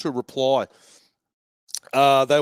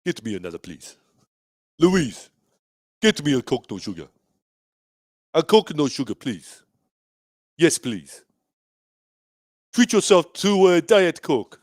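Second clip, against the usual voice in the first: put-on Nigerian accent with falling line ends; playful African-accented request. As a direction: order a drink in a mock Nigerian accent. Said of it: "talking like a Nigerian".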